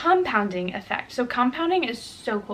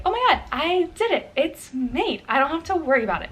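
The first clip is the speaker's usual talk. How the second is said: higher-pitched, mock-delighted inner voice; pleased self-congratulation; 4 semitones higher than usual. Viewer to self after making the bed, pleased. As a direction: voice a little burst of pride, lightly acted. Acting out reward of made bed.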